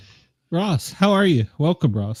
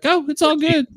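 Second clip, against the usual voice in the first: mock breezy voice; 14 semitones higher than usual. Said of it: "they're like".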